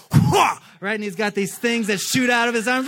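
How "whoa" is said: high, loud startled cry; mock startled whoa. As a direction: jump in surprise, hammed up. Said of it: "the second he's like".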